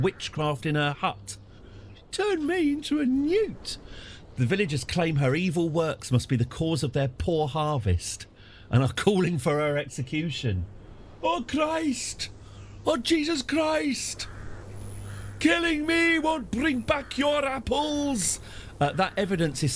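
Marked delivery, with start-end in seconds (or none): 2.1-3.8 very high, slow falsetto crone pleading; squeaky witch voice. Acted. Accused witch mocking the villagers in a high, slow falsetto. 11.2-18.4 very high, slow falsetto wail; wailing witch. Acted. Accused witch wailing at villagers in a very high, slow falsetto.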